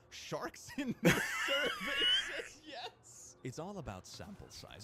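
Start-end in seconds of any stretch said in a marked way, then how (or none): 0.1-3.3 high, slow, laughing delivery; laughing punchline. Real, not acted. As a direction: crack up on the punchline.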